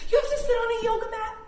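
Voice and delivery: high, fast and loud game call; bright playful squeal to the kids; excited squeal. Pretend. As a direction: squeal it out to get the kids moving. Playful game instruction exclaim.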